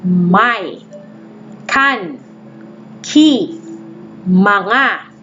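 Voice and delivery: slow, one word at a time; teacher reading phrase markers aloud; modelled reading. Shown as a demonstration. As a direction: read each function word clearly. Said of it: "I'll read it to you aloud".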